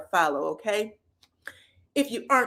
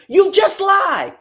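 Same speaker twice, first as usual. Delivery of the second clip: much higher and louder, drawn out; shouted accusation; 10 semitones higher, 10 dB louder, slower than usual. Retold or imitated. Tisha yelling back at Destiny. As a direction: yell it at her.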